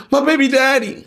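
high-pitched fake-tearful answer; mock crying. Girlfriend answering who hurt her, mock crying. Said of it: "Girl, you stop fucking crying".

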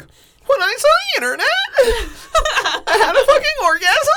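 high, loud, over-the-top hype shout; hyped-up Gary V impression. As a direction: scream it like an ecstatic hype guru. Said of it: "they're like the Gary V, where they're like".

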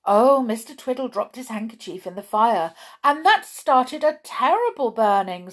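high, loud thrilled gossip; Sally thrilled to tell of the fire. Acted. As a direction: relish every dramatic word. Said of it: "Sally Simple was thrilled".

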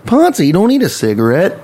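high, swooping exasperated mom; scolding mother. Acted. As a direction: scold the child with a rising pitch. Acted mother scolding her child.

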